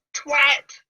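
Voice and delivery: sudden loud high yelp of an insult; mock shouted insult. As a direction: blurt it out as a shout, then run. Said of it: "you just go".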